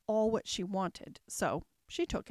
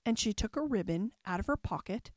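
usual speaking voice

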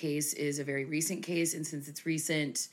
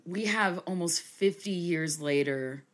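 normal talking voice

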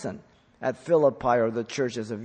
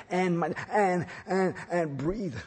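lightly voiced small child, higher, halting; little kid; 6 semitones higher than usual. Preacher mimicking a small child's halting "and, and" speech. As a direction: imitate a child stringing words with "and". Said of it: "like when a little kid".

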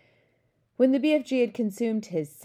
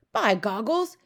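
higher, louder exclamation; cried out; 4 semitones higher, 6 dB louder, slower than usual. A narrator's reading. Giant exclaiming over his food, cried out. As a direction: exclaim it high and forcefully. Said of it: "he cried".

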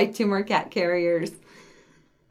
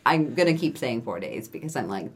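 ordinary talk, nothing marked

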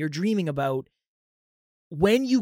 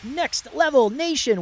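high, slow hype greeting; excited call-out; 9 semitones higher, slower than usual. Acted. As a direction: call out to the fans.

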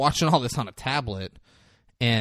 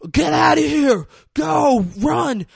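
loud, high imitated yell; urgent warning shout; 14 semitones higher, 6 dB louder, slower than usual. Bloodied man at the ambulance window, shouting a warning. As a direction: shout them away in alarm.

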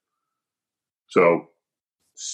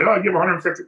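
mocking; 10 semitones higher, faster than usual. Mocking what people say.

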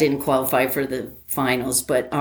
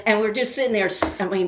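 normal talking voice

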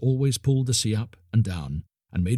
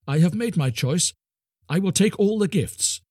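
higher, eager little creature voice; Po Amma's choice; 9 semitones higher, 3 dB louder than usual. A narrator's reading. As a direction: announce it proudly, voice lifting high. Po Amma decides.

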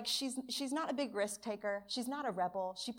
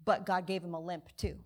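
ordinary talk

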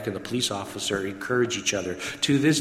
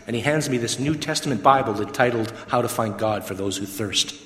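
normal talking voice

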